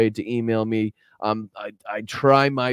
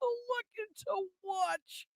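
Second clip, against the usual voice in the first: high, slow, soft mocking echo; 16 dB quieter, slower than usual. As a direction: parrot the words back in a tiny high voice. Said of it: "Oh, my Lanta".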